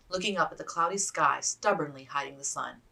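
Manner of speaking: plain reading voice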